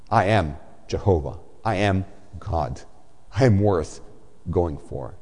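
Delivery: voice of God. Acted. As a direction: declare it gravely as God, low. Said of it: "And God responds".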